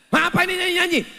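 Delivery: high, loud, fast outburst; annoyed shout; mock angry husband. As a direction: snap at the singing wife.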